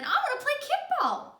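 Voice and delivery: high, loud whine; high whiny child voice; whiny Ron. Acted. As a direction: whine it like a little kid. Ron asking again.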